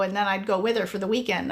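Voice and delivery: usual speaking voice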